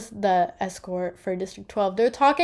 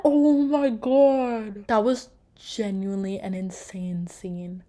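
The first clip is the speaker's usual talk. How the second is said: hushed awe; slower than usual. Real, not acted. Still shaken by the scene.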